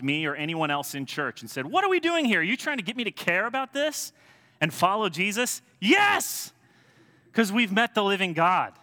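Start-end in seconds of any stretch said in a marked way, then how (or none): 1.7-5.6 very high, loud indignant questioning; incredulous challenge. Acted. Sceptic stopping someone in church, loudly incredulous. 5.8-8.8 high, shouted fervent yes; impassioned affirmation. Acted. Believer answering a sceptic in church, fervent shouting.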